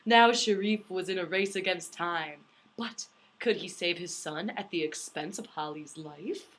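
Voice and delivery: slow, dramatic back-cover read; blurb reading. Acted. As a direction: read the synopsis like a movie trailer. Reads book blurb aloud.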